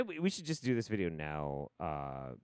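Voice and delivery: ordinary talk, nothing marked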